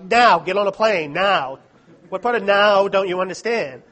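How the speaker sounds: loud, insistent demand repeated; retold shout. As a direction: shout it down the phone, hammering the word now. Retelling a frantic phone call.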